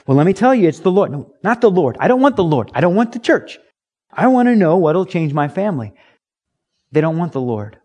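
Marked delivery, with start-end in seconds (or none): 0.1-1.1 eager witness. Retold or imitated. 1.1-5.9 insistent refusal. Retold or imitated. Voicing unbeliever refusing.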